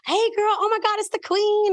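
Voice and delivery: high, bright call across the room; excited greeting. Retold or imitated. Waiters calling across room.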